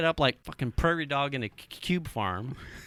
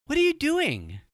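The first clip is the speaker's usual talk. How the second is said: much higher-pitched imitation of the daughter; imitated call-out; 12 semitones higher than usual. Daughter calls from her room, high imitated question. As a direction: pitch it way up, as someone calling from behind.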